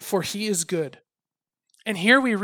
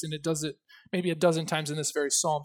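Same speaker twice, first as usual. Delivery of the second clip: ordinary talk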